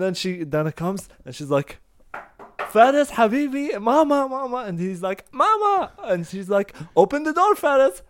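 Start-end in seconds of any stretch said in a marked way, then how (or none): 2.7-4.6 high, sweet coaxing call; coaxing mother. Retold or imitated. Mother coaxes her son through the locked door, high and sweet. 5.3-5.9 put-on high, loud cheerful toddler reply. Toddler inside answers his nanny, high and chirpy. 7.0-8.0 high, loud call through the door; calling to child. Retold or imitated. Mother calls through the door to her son, high and loud.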